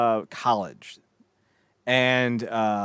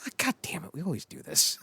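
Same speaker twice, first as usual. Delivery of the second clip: softer, higher weary complaint; resigned grumble; 5 semitones higher, 6 dB quieter than usual. Acted. Prisoners shrug after the killing, soft resigned grumble. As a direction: grumble it as a long-suffering regular.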